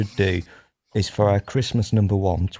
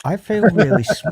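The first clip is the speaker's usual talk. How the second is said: laughing; 9 semitones higher, 5 dB louder than usual. Real, not acted. Breaks into laughter mid-apology.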